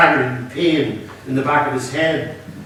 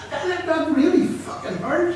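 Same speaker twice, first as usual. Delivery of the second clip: pained complaint. Acted. Hostage rubbing his bleeding head.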